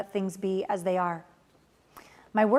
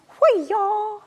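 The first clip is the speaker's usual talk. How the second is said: mock battle cry; 10 semitones higher, 5 dB louder than usual. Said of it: "You just".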